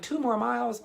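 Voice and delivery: high incredulous squeak of disbelief. Retold or imitated. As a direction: shriek it like you can't believe it.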